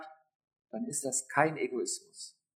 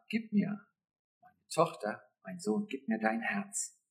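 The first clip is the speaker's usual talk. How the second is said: soft pleading God; 7 dB quieter than usual. Retold or imitated.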